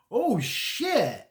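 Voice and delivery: high, loud, startled "oh shit"; shocked reaction. Retold or imitated. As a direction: blurt it out, caught off guard. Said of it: "And I'm like".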